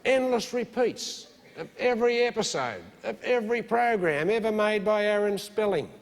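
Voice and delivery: mock preaching build. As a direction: sell the apocalypse to the congregation. Building televangelist rant.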